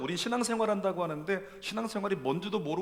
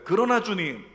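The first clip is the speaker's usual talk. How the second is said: loud declaration for the congregation to repeat; call and response; 4 semitones higher, 9 dB louder than usual. Shown as a demonstration. Preacher leading the church in repeating, loud proclamation. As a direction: proclaim it so they echo you. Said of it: "우리 한번 따라할까요".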